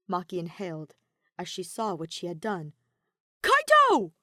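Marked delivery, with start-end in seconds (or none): 3.4-4.1 much higher, louder alarmed call; robot crying out as friend is hit. A narrator's reading. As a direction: cry his name in alarm. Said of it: "K one B zero cried out".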